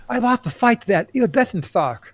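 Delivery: high-pitched lisping boxer voice; Mike Tyson impression. Host plays the boxer beaten by a shark, lisping Mike Tyson impression. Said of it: "Now I'm talking like this".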